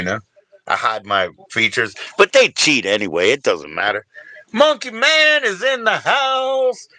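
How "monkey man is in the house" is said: high, slow, drawn-out hail; announcing an arrival. Pretend. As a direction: herald the newcomer in a stretched high call.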